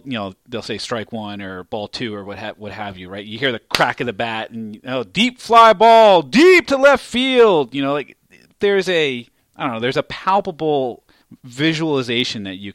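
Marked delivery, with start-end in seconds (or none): 5.0-7.8 loud, high, drawn-out home-run style call; imitating play-by-play announcer. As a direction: belt it out slowly like a live baseball call. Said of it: "the play-by-play announcer has to bring that to you".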